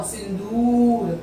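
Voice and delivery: सिंदूर said slowly with a long दू; slow teaching model. Shown as a demonstration. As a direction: stretch the दू in सिंदूर. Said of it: "दू पे long press हो रहा है".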